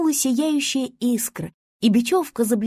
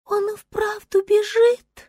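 high, slow star voice; reviving star; 10 semitones higher, slower than usual. A narrator's reading. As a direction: marvel slowly in a high voice. Reviving star sees the bear. (удивлённый высокий голос звезды)

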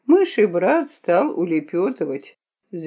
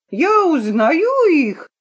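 high, drawn-out accusing yell; 9 semitones higher, 5 dB louder, slower than usual. A narrator's reading. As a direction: cry it out loud and high. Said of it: "вопил Грошек". (громкий высокий вопль)

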